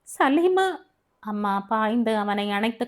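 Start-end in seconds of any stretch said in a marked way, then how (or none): 0.1-0.7 sudden high call of the son's name; anguished cry of name. Acted. Mother crying out to her frightened son, high anguished call. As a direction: cry out his name in alarm.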